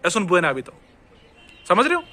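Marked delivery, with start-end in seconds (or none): 0.1-0.7 quick, linked "Es un buen hábito"; faster speech. Shown as a demonstration. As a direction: slide buen straight into hábito. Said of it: "लेकिन fast कैसे बोलूंगा".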